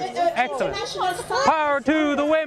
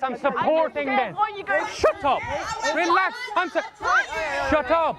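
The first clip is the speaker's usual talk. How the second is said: ordinary delivery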